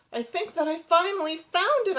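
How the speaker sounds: high, loud excited exclaim. Acted. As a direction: burst out with excitement. Excited discovery.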